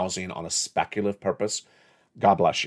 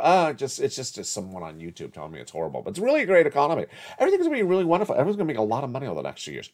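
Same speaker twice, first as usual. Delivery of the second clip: high, fast mock cheerfulness; mock naive optimist; 7 semitones higher, much faster than usual. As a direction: parody the carefree viewer, rushing. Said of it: "you may have thought".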